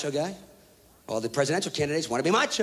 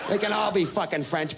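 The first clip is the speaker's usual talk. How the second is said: normal talking voice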